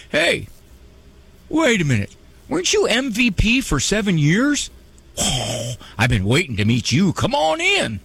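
put-on jokey Southern accent, big pitch leaps on "Hey" and "Come on in". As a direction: play God as a friendly Southern host welcoming a star. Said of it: "Notice how I gave God a southern accent".

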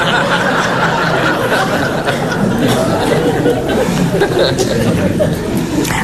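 long unvoiced laughter; breathy laugh. Real, not acted. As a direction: wheeze with laughter.

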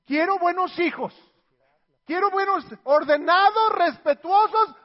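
más agudo y fuerte, insistente; exigencia airada. Acted. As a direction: reclama como la clienta del banco. Padre exigiendo hijos buenos. (madre exigiendo a gritos hijos buenos)

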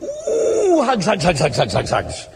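high squeal, then fading hugs; playful hug greeting. Retold or imitated. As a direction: coo over a child as you squeeze her. Uncle hugging little niece.

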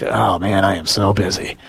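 low, put-upon mock groan; mock busy complaint. Priest mimicking priests comparing workloads when they meet, mock complaint. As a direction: imitate a colleague moaning about workload. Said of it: "the first thing they do, you know, it's like".

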